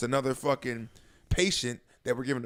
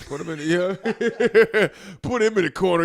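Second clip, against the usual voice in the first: high and louder, slow, laughing through it; loud laughing order; 6 semitones higher, 7 dB louder than usual. Retold or imitated. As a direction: bark it out while cracking up.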